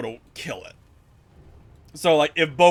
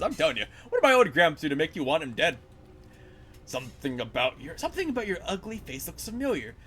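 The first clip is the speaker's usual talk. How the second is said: ordinary talk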